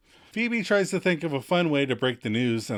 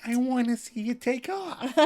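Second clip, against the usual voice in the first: Emily voice; 11 semitones higher than usual. Retold or imitated.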